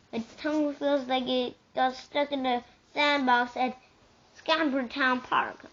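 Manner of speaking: plain reading voice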